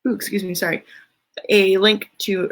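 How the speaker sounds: ordinary delivery, nothing marked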